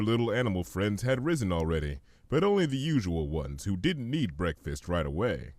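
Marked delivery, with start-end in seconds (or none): none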